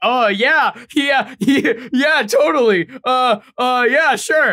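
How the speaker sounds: high, jittery yeah yeah while sweating; nervously yanking at a collar; a hint of flustered yes-man; nervous agreement. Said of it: "yanking on their collars".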